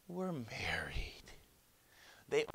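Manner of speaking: slow, soft, breathy reveal; tender storybook hush; hushed happy ending. A narrator's reading. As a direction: whisper it sweetly, drawn out. Savoring the fairy tale's happy ending.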